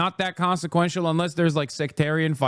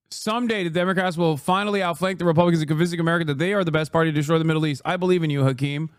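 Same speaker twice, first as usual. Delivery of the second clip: ordinary talk, nothing marked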